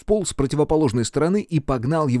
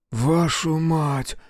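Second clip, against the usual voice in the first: slow, drawn-out curse, pitched higher; exhaled curse; 5 semitones higher, much slower than usual. Acted. Engineer sees the bomb and runs, exhaled curse. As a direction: let the oath out on a long breath. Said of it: "выдохнул инженер".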